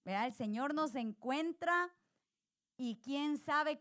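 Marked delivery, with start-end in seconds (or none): none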